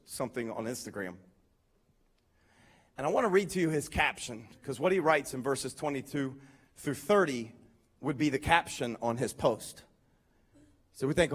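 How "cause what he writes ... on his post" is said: usual speaking voice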